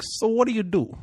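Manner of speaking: chatty mock Puerto Rican driver; bad Puerto Rican accent. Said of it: "I'm gonna do it bad accent".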